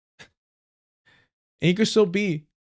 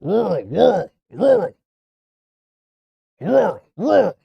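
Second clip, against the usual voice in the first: high, loud yelping barks of the word; barking dog; 4 semitones higher, 5 dB louder than usual. Acted. Streamer mocking rural voters as barking dogs. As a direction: bark "Rural" like a yapping dog. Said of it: "It's like they're fucking barking".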